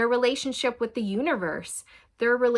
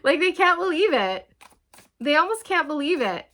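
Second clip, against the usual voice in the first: much higher and louder, amazed; delighted disbelief; 6 semitones higher, 5 dB louder than usual. Real, not acted. Reader thrilled at how amazed the partner is, high and loud. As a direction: exclaim it like happy news.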